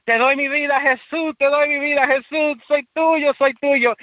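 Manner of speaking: very high, crying shout of surrender; desperate shout. Retold or imitated. Young man grabs the mic and cries out to the church, very high. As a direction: scream it to the whole church. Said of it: "empecé a gritar".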